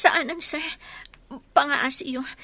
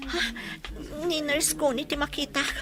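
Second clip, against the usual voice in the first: ordinary talk, nothing marked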